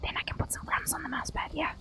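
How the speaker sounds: breathy ASMR whisper, mostly very soft. Real, not acted. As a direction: murmur everything as a quiet ASMR whisper. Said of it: "In my best A S M R voice".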